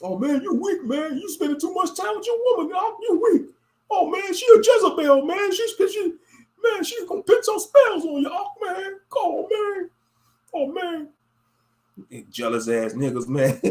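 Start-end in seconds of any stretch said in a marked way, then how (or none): 0.0-11.1 much higher, fast, wailing mock-cry; mocking whiny brother. Mocking a camp brother who calls the wife a Jezebel, with a high mock wail. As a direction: whine like a hurt camp brother.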